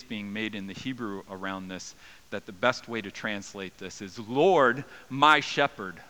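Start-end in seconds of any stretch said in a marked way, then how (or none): 4.2-6.0 loud, ringing call to God; vocative cry. Shown as a demonstration. Preacher showing the Hebrew reading, loud vocative cry to God. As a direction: cry it out, addressing God directly. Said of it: "it's a calling out to God".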